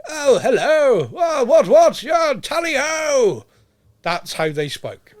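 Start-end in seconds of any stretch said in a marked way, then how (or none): 0.1-3.4 high, loud, slow plummy posh English; hammed-up shouted posh English voice; posh RP pirate. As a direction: lampoon an upper-class RP toff. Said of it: "every pirate sounded like".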